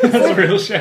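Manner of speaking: high loud words through laughter; giggling it out high and loud; laughing. Real, not acted.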